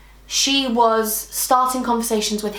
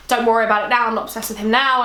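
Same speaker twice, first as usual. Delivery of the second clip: ordinary talk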